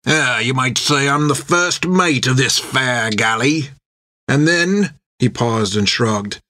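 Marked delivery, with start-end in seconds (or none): 0.1-4.9 put-on slow, drawn-out old sailor voice; rough first mate, mocking welcome. A narrator's reading. First mate welcomes new prisoners aboard, mock-courteous sailor drawl. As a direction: play a leering prison-ship mate.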